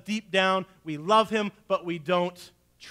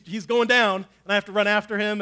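usual speaking voice

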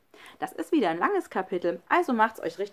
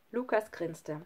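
ordinary delivery

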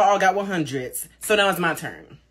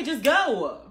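usual speaking voice

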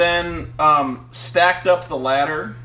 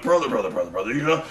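low mumbled string of repeats; Hulk Hogan; 7 semitones lower, 3 dB quieter, much faster than usual. Retold or imitated. Host mocks a wrestler's catchphrase, low muttered impression. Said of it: "and he's like".

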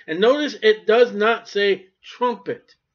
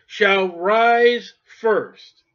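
slow emphasis; 2 semitones higher, 6 dB louder, much slower than usual. Acted. Preacher stressing scripture line.

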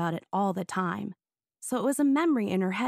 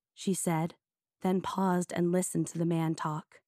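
ordinary delivery, nothing marked

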